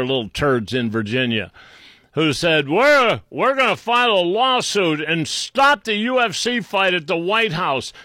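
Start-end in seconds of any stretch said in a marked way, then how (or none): 2.7-7.9 mock-indignant complainers, pitched up; mocking imitation. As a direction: ridicule them with a raised, puffed-up voice. Mimicking two litigants he ridicules.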